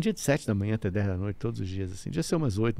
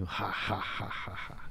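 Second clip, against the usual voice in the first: low eerie panting ha ha, much softer; different voice; 4 semitones lower, 11 dB quieter, slower than usual. Retold or imitated. Therapist retelling client's eerie possessed voice, low and soft. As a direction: breathe out a creepy possessed ha ha. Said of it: "ele começa a fazer uma voz diferente".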